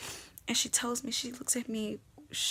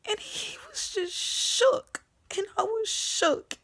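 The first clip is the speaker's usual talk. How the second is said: shocked, rising; 9 semitones higher, 3 dB louder, slower than usual. Retold or imitated. Shock at seeing him.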